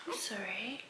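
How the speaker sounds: soft apologetic whisper. Real, not acted. Apologizing to baby quietly.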